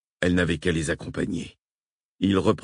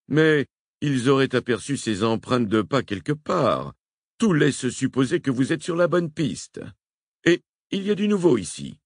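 amiral faisant son rapport; 7 semitones higher than usual. A narrator's reading. Admiral's long radio report.

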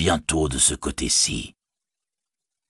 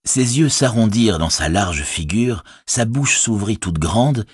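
ordinary delivery

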